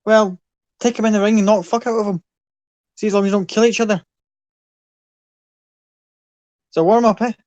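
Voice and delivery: roaring Irish fight boss; Irish accent. Acted. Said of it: "Your Irish accent is fantastic".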